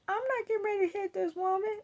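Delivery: high, fast, disbelieving squeal; incredulous inner voice. Retold or imitated. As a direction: say it like you can't believe she asked. Inner refusal to hit stranger.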